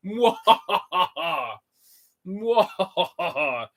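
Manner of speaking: slow evil cackle; drawn-out villain laugh; mad scientist. Acted. Villain laugh after reveal.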